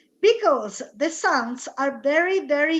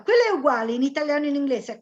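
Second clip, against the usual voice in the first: ordinary talk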